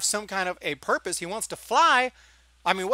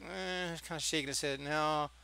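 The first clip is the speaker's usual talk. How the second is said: low, soft reluctant mumble; noncommittal no; 4 semitones lower, 11 dB quieter than usual. Retold or imitated. Imitating Han kinda shaking his head, noncommittal no. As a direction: half-heartedly shrug off the offer. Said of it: "his no was not very".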